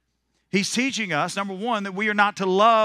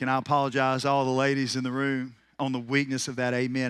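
ordinary delivery

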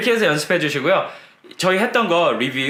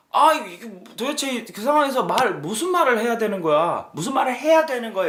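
exasperated, pitched-up complaint; frustrated nuance; 7 semitones higher than usual. Shown as a demonstration. Teacher acting out a student stuck for words, exasperated. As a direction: sound at a loss for words. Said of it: "이런 뉘앙스 있죠".

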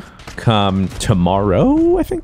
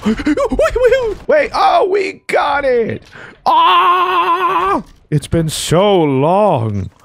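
very high, disbelieving squeal; real overjoyed gamer freakout; ecstatic celebration; much slower than usual. Real, not acted. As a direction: burst into high-pitched triumph, stretching the last words. Gets the long-awaited streak in game.

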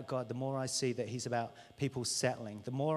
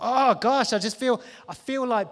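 longing exclaim; 8 semitones higher, 11 dB louder, slower than usual. Acted. Yearning would-be apostle.

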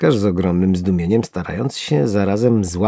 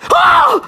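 very high, loud yelp; shrill squeal of alarm; horrified cry; 8 dB louder than usual. A narrator's reading. Worm seeing the hook come closer, alarmed shriek. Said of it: "Ów ostatni okrzyk wydał z siebie na widok haczyka".

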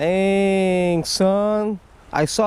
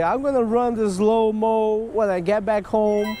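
usual speaking voice

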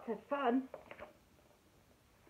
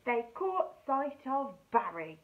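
plain reading voice

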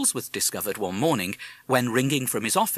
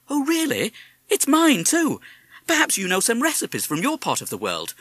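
high excited lady; 12 semitones higher, 4 dB louder than usual. Acted. Mrs Holsworth delighted.

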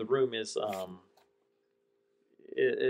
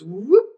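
whoosh sound; 8 semitones higher, 9 dB louder than usual. Pretend. Sound of shelf turning.

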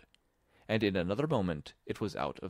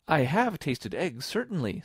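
put-on high, deliberate girl's admission; earnest high-pitched reply; truthful Alice; 6 semitones higher, 3 dB louder than usual. A narrator's reading. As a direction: admit it in a high girlish voice.

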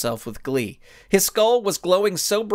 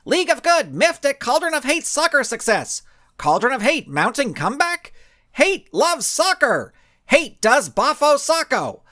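high, loud newsreel-style headline voice; headline read; 10 semitones higher, 3 dB louder than usual. A narrator's reading. Narrator reading out the morning's headlines in a loud, high voice. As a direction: punch out the headlines like a newsie.

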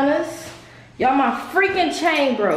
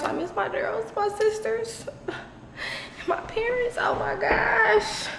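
high, soft, breaking into tears; tearful; 10 semitones higher, 5 dB quieter than usual. Real, not acted. Telling viewers she misses her family, tearfully. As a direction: choke up missing home. Missing family back in Detroit.

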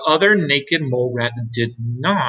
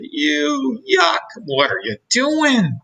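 disgusted mole rats; 12 semitones higher, slower than usual. Acted. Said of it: "they said".

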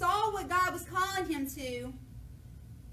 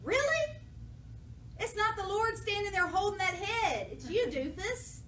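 high mocking "Really?" then scolding; mock incredulous David; sarcastic disbelief; 6 semitones higher than usual. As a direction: scoff at them in disbelief. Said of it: "And David's like".

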